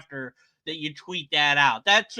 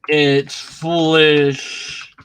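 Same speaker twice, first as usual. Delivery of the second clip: slow mock read; 8 dB louder, much slower than usual. Real, not acted. Mocking reading of premise.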